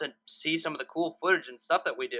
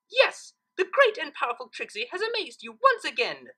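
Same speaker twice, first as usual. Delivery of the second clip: yelled; 10 semitones higher, 4 dB louder than usual. A narrator's reading. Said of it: "the unicorn yelled".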